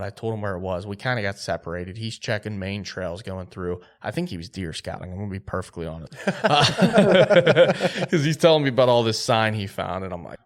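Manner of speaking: usual speaking voice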